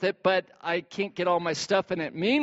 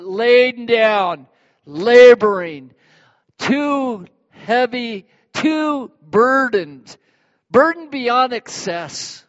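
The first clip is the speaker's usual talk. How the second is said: straining under weight; 4 semitones higher, 7 dB louder, slower than usual. Acted. Acting out a heavy burden.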